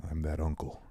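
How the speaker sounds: low, whispered aside; hushed aside. Real, not acted. Joking aside to the other host, hushed. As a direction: drop to a whisper for a quick joke.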